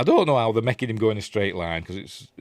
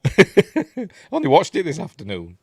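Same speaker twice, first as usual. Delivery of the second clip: laughing; 8 semitones higher, 7 dB louder than usual. Real, not acted. Laughing at own forgetfulness.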